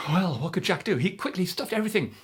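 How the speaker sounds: excited chase telling; breathless chase narration. A narrator's reading. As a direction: race through the escape. Frantic chase.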